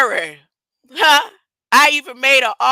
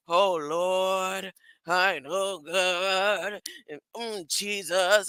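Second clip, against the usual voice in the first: imitating mother; 4 semitones lower, 7 dB quieter, slower than usual. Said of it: "when my mother was saying".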